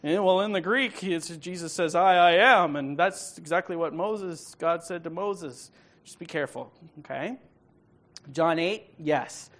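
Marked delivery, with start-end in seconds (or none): 0.0-5.7 lightly voiced smug friend arguing about the Greek; a mild parody of an overconfident student; mock know-it-all. Mocking a student lecturing friends, mildly smug. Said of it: "bad arguments when you go and talk to your friends".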